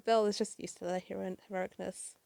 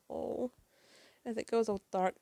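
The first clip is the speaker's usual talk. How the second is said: ordinary talk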